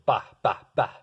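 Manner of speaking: "ba, ba, ba" with each note hit hard; hard attack on every "ba"; accented notes. Shown as a demonstration. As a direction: punch each separate "ba". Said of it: "you hit the note hard".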